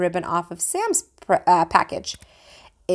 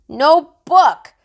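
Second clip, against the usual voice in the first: put-on loud, higher defiant child; stubborn toddler; 8 semitones higher, 8 dB louder than usual. A narrator's reading. Fudge refusing the book, loud and higher. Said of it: "Fudge said".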